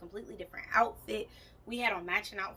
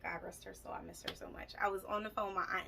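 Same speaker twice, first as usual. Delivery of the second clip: ordinary delivery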